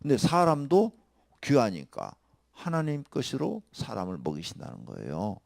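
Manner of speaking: normal talking voice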